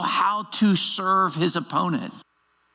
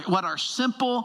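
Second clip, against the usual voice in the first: ordinary talk, nothing marked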